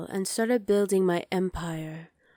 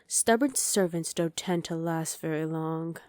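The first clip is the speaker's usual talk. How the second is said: usual speaking voice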